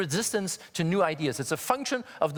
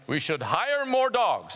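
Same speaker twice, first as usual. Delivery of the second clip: clueless executive; 3 semitones higher, slower than usual. Retold or imitated. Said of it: "these people go back and".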